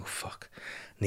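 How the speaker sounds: barely voiced swear; hushed cursing. Dad hearing the baby wake, cursing softly. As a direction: swear under your breath.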